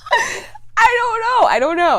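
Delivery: high, loud wail easing down; exasperated high cry, real fluster; flustered outburst. Real, not acted. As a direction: blurt it high and helpless. Flustered at weird-talent dare.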